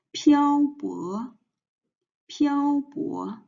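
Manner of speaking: 漂 held high and level, 泊 rising; first and second tones. Shown as a demonstration. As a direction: model 漂泊 slowly, flat high then rising. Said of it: "and the first tone".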